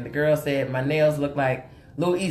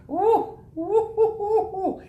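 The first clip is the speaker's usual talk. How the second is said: 1 delighted laughing ooh; slower than usual. Real, not acted.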